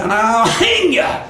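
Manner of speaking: slow threat, pitch swooping high; menacing Brer Fox. Acted. Fox threatens the trapped rabbit, slow rising menace. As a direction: land the threat slowly with relish.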